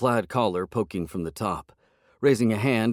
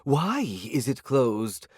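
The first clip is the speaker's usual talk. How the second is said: higher, slow indignant question; indignant cat; 6 semitones higher, much slower than usual. A narrator's reading. Hungry cat outraged at the shut restaurant, higher and slow. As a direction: drag it out in outrage.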